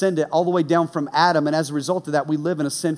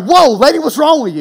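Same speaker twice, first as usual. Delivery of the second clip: loud and higher, jumpy startled protest; startled whoa; 8 semitones higher, 8 dB louder than usual. Retold or imitated. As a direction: jump back in shock at the clerk. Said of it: "I jumped".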